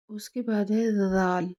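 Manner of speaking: normal talking voice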